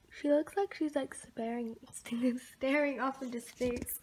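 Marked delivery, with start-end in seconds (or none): none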